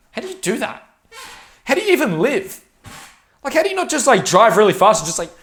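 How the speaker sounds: high-pitched, sputtering disbelief; incredulous disbelief. Retold or imitated. Asking an average coworker how he lives, baffled. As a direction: fumble for words, baffled. Incredulous questions retold to Lockie.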